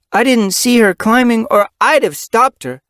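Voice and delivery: put-on proud young kit voice.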